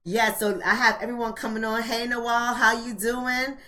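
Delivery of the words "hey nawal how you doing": ordinary talk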